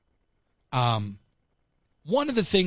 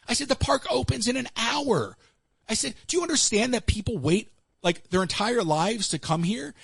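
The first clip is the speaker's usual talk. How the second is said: indignant retort; 7 semitones higher than usual. Retold or imitated.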